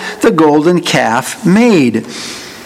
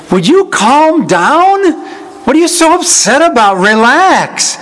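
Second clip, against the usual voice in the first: much higher, indignant push-back; exasperated, unmeek retort; 8 semitones higher than usual. Acted. Aaron answering an angry Moses, exasperated and high-pitched. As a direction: act it irritated, pitched right up. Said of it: "Was it meekly? Or was it".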